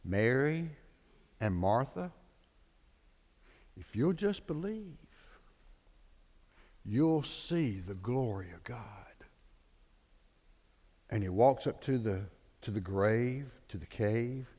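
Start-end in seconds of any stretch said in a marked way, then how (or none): 0.0-8.8 softer, gentle reassurance fading to a whisper; calm them with quiet certainty; Jesus calmly reassuring the sisters. Retold or imitated. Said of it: "calmly looked at him and said".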